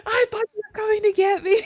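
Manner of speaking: high, loud, fast tearful wail; mock crying. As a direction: bawl it accusingly, hammed up. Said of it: "I'm crying so".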